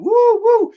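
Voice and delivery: loud, high-pitched whooping scream; crazed high "woo" yelps; mock scream. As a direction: imitate wild taunting whoops at full pitch.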